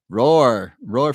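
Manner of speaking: loud called-out "roar"; big mock-lion shout of the word; playful roar. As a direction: belt the word out as a lion greeting. Playful roar greeting to viewers.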